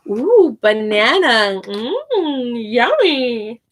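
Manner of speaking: slow, delighted ooh over banana; delighted teacher demo. Shown as a demonstration. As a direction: act thrilled by the banana for kids. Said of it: "I add fruit to it and I say".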